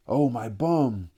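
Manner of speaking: rueful cry. A narrator's reading. Robert after the beating, trying to save face, a slow rueful cry. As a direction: complain of the sore rump, drawn out. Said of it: "rubbed his rump ruefully".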